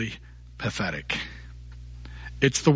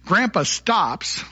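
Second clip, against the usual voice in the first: usual speaking voice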